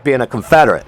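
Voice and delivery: ordinary talk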